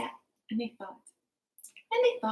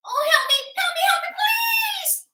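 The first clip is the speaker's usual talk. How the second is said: very high, loud squeaky cries for help; a tiny trapped fairy shrieking; high-pitched fairy wail; tiny fairy crying for help; 7 dB louder than usual. Acted. Fairy stuck, calling for help.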